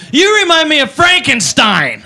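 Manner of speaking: slow, lightly slurred taunt; drunk girl. Acted. Comic mimicking the drunk girl at the party. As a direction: drag it out like a tipsy insult. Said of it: "She goes".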